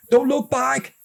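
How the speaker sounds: very high urgent yell. A narrator's reading. Big brother yells back while running, high and urgent. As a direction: warn him over the shoulder. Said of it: "Kieran yelled".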